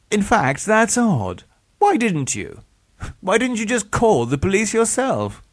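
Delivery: high, quizzical questioning; puzzled villain. A narrator's reading. Villain wondering why his victim never called police, puzzled.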